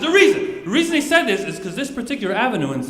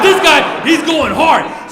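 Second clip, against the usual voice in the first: emphatic; 5 semitones higher, 7 dB louder, much slower than usual. Real, not acted. Emphatic narration of aggressive cop.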